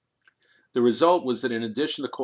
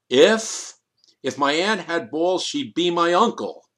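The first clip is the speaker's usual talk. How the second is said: loud, higher bellowed retort; bellowing; 5 semitones higher, 5 dB louder, slower than usual. A narrator's reading. Kriegel bellowing at Fisher while storming out, loud and higher. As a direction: roar it at him as you leave. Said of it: "Kriegel bellowed".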